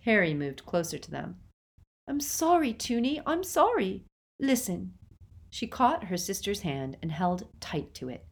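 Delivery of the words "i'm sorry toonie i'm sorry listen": high, pleading little sister. A narrator's reading. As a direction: plead apologetically, pitched well up. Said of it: "seemed to be pleading".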